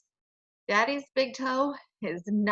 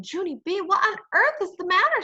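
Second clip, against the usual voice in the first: high, louder alarmed mother; 9 semitones higher, 5 dB louder than usual. Acted. Mother rushes in at Junie's scream, high alarmed call. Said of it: "said Mother".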